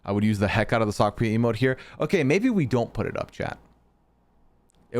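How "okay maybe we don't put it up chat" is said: ordinary talk, nothing marked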